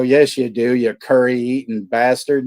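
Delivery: loud, scornful snap back at the caller; hammed-up furious comeback; mock angry retort. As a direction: throw the insult at the spammer. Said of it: "I go".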